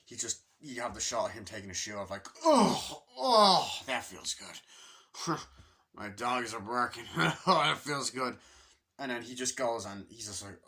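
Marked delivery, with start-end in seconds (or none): 2.5-8.3 mock relief. Salesman pulls shoes off on the plane, loud mock relief. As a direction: savour taking the shoes off.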